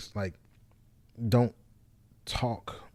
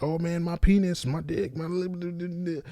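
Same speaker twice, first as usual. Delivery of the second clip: higher, fading softer, rambling crude mock; mocking old self; 8 semitones higher, 3 dB quieter than usual. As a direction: send up your old crude jokes, trailing off. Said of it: "a lot of it's like".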